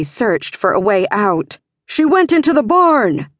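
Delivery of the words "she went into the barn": high, loud shout; shouted alarm. A narrator's reading. Searcher alerting the others, shouting. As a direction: call it out across the yard. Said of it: "someone shouted".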